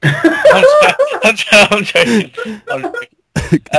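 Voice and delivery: breaking into high laughter while insisting he's joking; laughing. Real, not acted. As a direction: squeal the words out through real laughter. Laughing through a mock threat.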